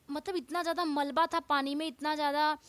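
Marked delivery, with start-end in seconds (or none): none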